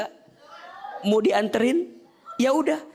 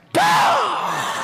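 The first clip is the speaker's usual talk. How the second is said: a sudden loud, high "Dah!" for the crash; pan slam shout; 4 dB louder than usual. Acted. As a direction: bang it out like a pan hitting the floor. Pan slammed down in the kitchen.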